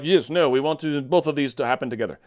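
light mock Russian accent, voicing Finland; a hint of a Russian accent, speaking for Finland. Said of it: "sort of a Russian-y accent".

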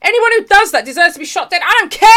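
furious declaration, voice up high; angry rant. Real, not acted. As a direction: spit it out in outrage. Angry rant about burglars.

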